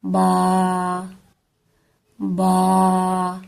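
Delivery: با held one alif long; lengthened ba. Shown as a demonstration. Teacher shows the long vowel in با. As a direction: stretch the vowel of با. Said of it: "ایک الف جتنا لمبا کر کے پڑھیں گے".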